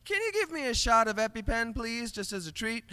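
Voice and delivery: high-pitched playful pleading; mock sweet request. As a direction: ask for it high, like a little treat. Retelling own plea in the ER.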